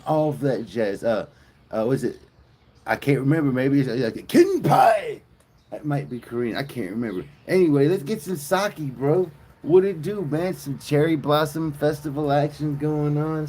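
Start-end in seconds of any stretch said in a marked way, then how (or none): 4.3-5.2 high, loud shouted toast. Acted. 7.5-13.5 higher, louder party chatter; hyped up. Acted.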